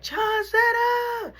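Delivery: high drawn-out call of her name. Retold or imitated. Friends greeting her in the hallway, high drawn-out call. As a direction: sing out her name down the hall.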